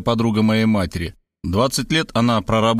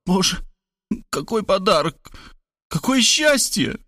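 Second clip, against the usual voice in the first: high, awed gush; overwhelmed, half-whispered high exclamation; overcome whisper; 8 semitones higher than usual. A narrator's reading. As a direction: gasp out joy in a high voice. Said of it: "схватилась за сердце и прошептала".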